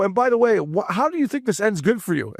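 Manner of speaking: high-pitched retold challenge; mock threat. Fan challenging his heckler at the ballpark, mock threatening.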